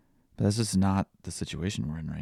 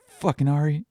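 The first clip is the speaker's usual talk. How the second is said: put-on higher, louder little-kid scolding; toddler voice; 9 semitones higher, 7 dB louder than usual. Dad imitating daughter scolding the dog, high kid voice. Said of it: "she goes".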